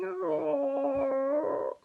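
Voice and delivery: mock sad lion roar, rising; crying roar. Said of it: "He was crying".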